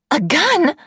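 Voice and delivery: high, alarmed question; shocked gasp. A narrator's reading. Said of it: "the nurse gasps".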